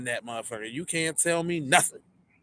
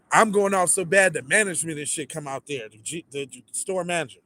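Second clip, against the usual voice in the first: ordinary talk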